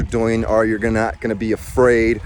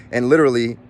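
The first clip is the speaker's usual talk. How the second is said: ordinary talk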